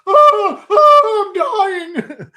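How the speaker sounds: high, loud hammed-up dying moan; mocking the knocked-over tripper, a high melodramatic wail. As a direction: overplay the tripper's despair. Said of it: "He'd probably be sitting there like".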